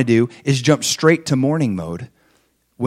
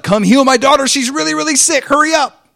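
high, pleading cry of a frantic father; desperate plea; 12 semitones higher, 6 dB louder than usual. Retold or imitated. Father begging Jesus to hurry and heal his daughter, high urgent plea. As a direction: beg urgently, pitched way up.

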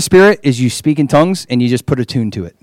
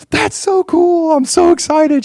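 very high, drawn-out imitated squeal; excited; slower than usual. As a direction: imitate someone giddy, way up high. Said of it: "she was so excited".

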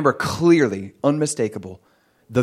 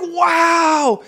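much higher, joyful shouting; overjoyed. Retold or imitated. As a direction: cry out in happy amazement. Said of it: "I was like".

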